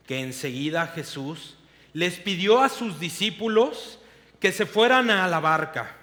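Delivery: expressive storytelling read of scripture; dramatic scripture reading; Bible story narration. Acted. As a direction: read the Gospel like a vivid story to the congregation. Reads Gospel passage aloud.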